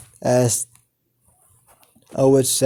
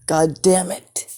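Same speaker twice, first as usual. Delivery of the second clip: higher, drawn-out curse; exasperated, pitched up but not shouted; acted frustration; 6 semitones higher than usual. A narrator's reading. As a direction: curse slowly through mounting exasperation. Frustrated curse while kicking Joker.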